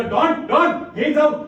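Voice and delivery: high, very loud yell out of a window; excited shout. Acted. Boy yells cricket news to Don from his window, excited shout. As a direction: shout the news at the top of your voice.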